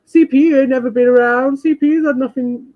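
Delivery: high-pitched mocking imitation of a snidey suck-up; mock ingratiating. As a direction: imitate someone cosying up, pitched well up. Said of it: "ropey and so snidey".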